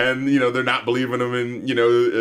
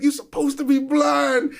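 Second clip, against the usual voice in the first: accusing; 11 semitones higher than usual. Retold or imitated. Riley accusing Granddad.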